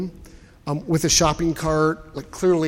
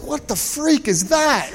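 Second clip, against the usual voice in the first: startled shock; 10 semitones higher, slower than usual. Retold or imitated. Jumps back seeing an opossum.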